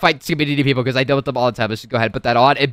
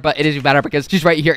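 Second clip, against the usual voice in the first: ordinary talk, nothing marked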